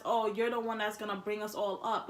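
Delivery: ordinary talk, nothing marked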